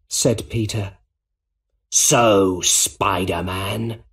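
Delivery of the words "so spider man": slow, drawn-out villain voice; a hint of menace, stretched out; Green Goblin. A narrator's reading. Villain greeting his enemy in the burning building, slowly menacing.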